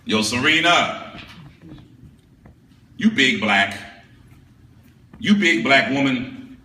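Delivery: rhythmic dozens-style chant; spoken-word ode. Acted. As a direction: hype her up line by line.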